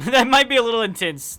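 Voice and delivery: loud embarrassed laugh through the words; laughing loudly. Real, not acted. Embarrassed laugh at kink art.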